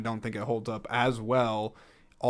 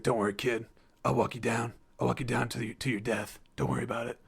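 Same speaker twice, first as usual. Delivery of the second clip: Bruce Willis; 4 dB quieter, faster than usual. Acted. Hero reassuring a kid before his sacrifice, lightly gruff impression. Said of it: "That's my Bruce Willis impression".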